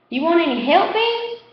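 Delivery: put-on high, rising boyish offer; offering kid. A narrator's reading. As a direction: offer help hopefully. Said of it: "another one offers".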